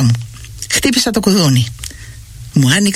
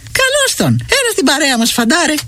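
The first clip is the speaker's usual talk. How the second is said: much higher, bright mother's greeting; a mother's hearty welcome; welcoming mother; 9 semitones higher than usual. A narrator's reading. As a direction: welcome him in like a cheerful mum. Mother welcomes son warmly.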